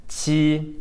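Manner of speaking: qi drawn out on a high level tone; pinyin Q demo. Shown as a demonstration. Teacher showing pinyin Q, demonstrating qi. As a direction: hold qi high and even, like a clean ch. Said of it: "pinyin Q is pronounced".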